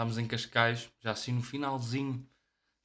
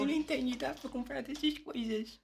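put-on much higher childish voice; mock little kid; 13 semitones higher than usual. Teen mocks himself as a little kid excusing himself to the stranger. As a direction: squeak it like a young kid making excuses.

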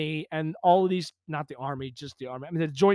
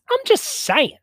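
mock emphatic repeat, much higher and louder; 13 semitones higher, 9 dB louder, slower than usual. Shown as a demonstration. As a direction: jump way up and louder on I'm.

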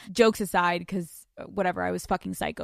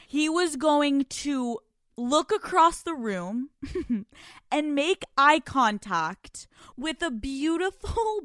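normal talking voice

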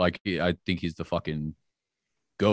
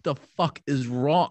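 incredulous; 7 semitones higher, slower than usual. Retold or imitated. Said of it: "I'm like".

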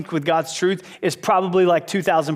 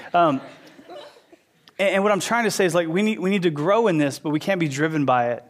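usual speaking voice

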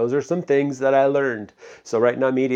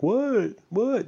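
high, puzzled dog voice asking what; dog's puzzled what; 9 semitones higher than usual. Retold or imitated. Pastor voices his dog reacting to being laughed at, high puzzled what. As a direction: voice the dog as if he's baffled, pitched up high. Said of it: "he's like".

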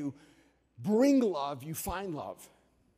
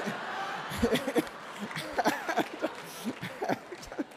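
real laugh; 6 semitones higher than usual. Real, not acted. Laughing after the story beat.

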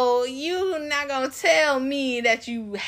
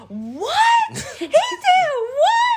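shocked friend; 5 dB louder, much slower than usual. Retold or imitated. Best friend hears shocking news.

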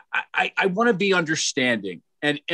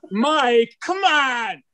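raised, louder playful protest; mock exasperation; 5 semitones higher, 4 dB louder, much slower than usual. Real, not acted. Comic to Mike over the laughter, mock exasperated. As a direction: scold your friend in jest.

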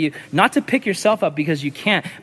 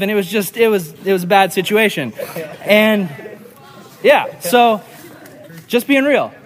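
ordinary talk

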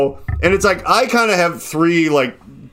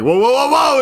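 higher, rising string of whoas; alarmed retold whoas; 10 semitones higher than usual. Teller acts out dodging swinging two-by-fours, alarmed. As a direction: blurt out a comic warning. Nearly hit by lumber.

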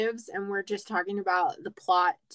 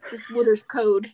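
Pittsburgh Yinzer vowels; Yinzer accent; 4 dB louder than usual. Shown as a demonstration. Co-host asked for a demo, Yinzer accent. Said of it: "can you do a Yinzer accent".

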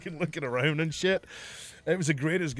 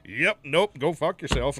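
blunt brush-off; 3 semitones higher, 7 dB louder than usual. Retold or imitated. Wrestler rudely hangs up.

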